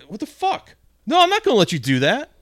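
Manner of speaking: high, loud, indignant yelling; outraged customer refusing the clerk; angry outburst. Retold or imitated. Customer yelling at young clerk about his receipt, loud and outraged.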